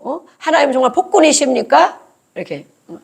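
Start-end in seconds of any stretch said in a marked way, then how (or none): 0.4-2.0 higher, rising accusatory question; confronting God. Acted. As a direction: challenge God in acted indignation. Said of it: "정말 따지고". (따지듯 끝을 올려 묻기)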